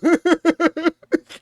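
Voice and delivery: high burst of laughter at own rant; short amused laugh. Real, not acted. Laughs after telling-off.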